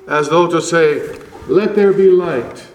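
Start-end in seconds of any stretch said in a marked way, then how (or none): 1.5-2.7 high and loud proclamation; creating command. Acted. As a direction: proclaim it like the first word of creation. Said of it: "another command".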